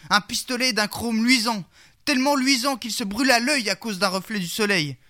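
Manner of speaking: normal talking voice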